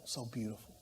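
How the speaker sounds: normal talking voice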